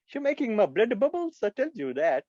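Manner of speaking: high, hammed-up Indian accent; put-on Indian accent. Impressionist complaining of blood boiling, Indian accent. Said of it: "He did the voice".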